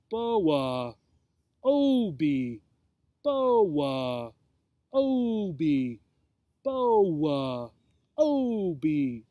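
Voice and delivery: each name stretched into a long falling glide; deep, melodious. A narrator's reading. Snake repeats the two names, deep melodious voice. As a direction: croon the names in slow, even alternation. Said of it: "repeated their names several times in that deep, melodious voice".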